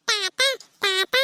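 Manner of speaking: put-on toddler repeating a new word; baby's first words. Granddaughter echoes the word for grandpa, babyish. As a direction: say it in separate little baby utterances. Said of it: "ತಾತ ಅಂಬತ್ ಕಲ್ತ್ಬಿಟ್ಟವಳೆ ನನ್ ಮೊಮ್ಮಗಳು".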